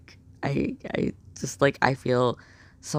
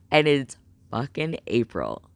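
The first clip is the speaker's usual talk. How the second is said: ordinary talk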